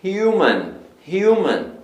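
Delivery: "human" as "HYOO-man", high then falling; sound of the U. Shown as a demonstration. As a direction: lift the "yoo" in "human", then drop. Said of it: "olha o som do U".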